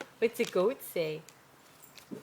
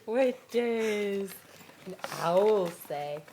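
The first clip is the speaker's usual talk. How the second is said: usual speaking voice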